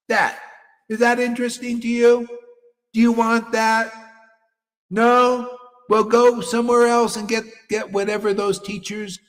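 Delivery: high, loud challenge to the audience; loud preacher's challenge; challenging shout. Real, not acted. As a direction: demand an answer, loud. Challenging listeners loudly.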